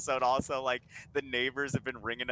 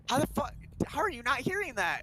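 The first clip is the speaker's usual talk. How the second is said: higher, put-on disbelief; exasperated question; 11 semitones higher than usual. Others baffled by the beeping alarm, high exasperated voice. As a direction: ask it fed up. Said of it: "And they're like".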